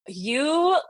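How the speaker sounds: mock a fed-up friend's accusation; imitated mad friend. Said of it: "he was so mad at me".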